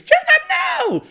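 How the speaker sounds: high mock scream; retold scream. Elena seeing Jenna with the knife, high mock scream. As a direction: shriek it in mock horror. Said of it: "and screams".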